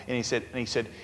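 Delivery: ordinary delivery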